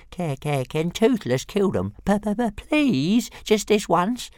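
very high, stammering, pleading creature voice; eager little dragon. A narrator's reading. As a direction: beg eagerly with drawn-out stutters. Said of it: "he said eagerly".

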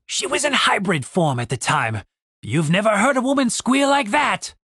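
lightly voiced rogue, pitch climbing at the end; a touch of relish in a character voice; reminiscing half-elf. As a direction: boast about a fond memory. Half-elf recalls a pleasant memory.

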